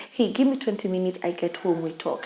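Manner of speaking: soft, low, retold reassurance; talking her down. Retelling a call to a suicidal schoolmate, quietly talking her down. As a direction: drop your voice to settle a caller. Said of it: "I'm trying to talk her down".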